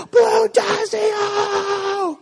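long, very high imitated toddler wail; mock a screaming, crying child, stretching the words out. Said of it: "he's crying, he's screaming".

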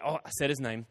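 a hushed oops under the breath; quiet aside. Real, not acted. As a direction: drop the voice as you catch yourself. Catches himself saying the name.

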